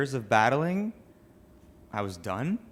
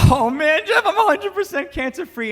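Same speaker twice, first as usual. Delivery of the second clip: much higher and louder, bursting with joy; ecstatic; 8 dB louder than usual. Retold or imitated. Telling cousin Jeff he is cancer-free, ecstatic. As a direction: shout the news like you can't hold it in.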